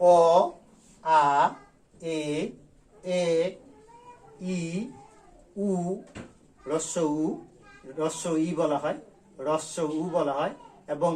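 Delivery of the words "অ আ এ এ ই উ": each vowel drawn out alone; teacher listing the basic vowel sounds; vowel demo. Shown as a demonstration. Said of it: "এই ষাটটি কি কি?".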